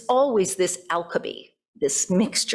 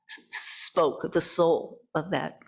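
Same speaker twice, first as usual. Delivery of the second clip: ordinary talk, nothing marked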